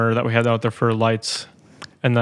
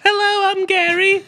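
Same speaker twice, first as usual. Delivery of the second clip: very high, slow falsetto with an English tinge; silly guest-host character; slower than usual. Acted. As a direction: squeak it out as a daft host. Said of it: "our guest host, Gary and Gary".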